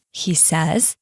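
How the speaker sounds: usual speaking voice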